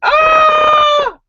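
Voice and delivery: put-on high, loud baby wail; imitated baby's cry. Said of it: "you hear the baby crying".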